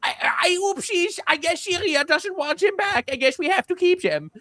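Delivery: very high, simpering put-on voice; meek mock Germany. Germany giving in to Syria's refusal, high meek mock voice. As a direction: imitate a helpless, oopsy pushover. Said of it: "Germany is just so absolutely cucked".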